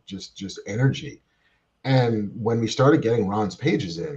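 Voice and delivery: usual speaking voice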